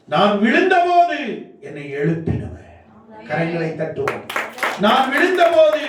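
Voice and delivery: high surging phrases with falling answers; impassioned preaching. Real, not acted. Preacher testifies to the congregation about his rescuer, impassioned preaching. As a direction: proclaim each line like a refrain.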